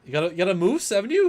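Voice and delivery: high, loud Canadian caricature ending as a question; mock Canadian accent. Shown as a demonstration. Co-host prompting with a moose line, mock Canadian accent ending "haven't you". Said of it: "do a Canadian accent".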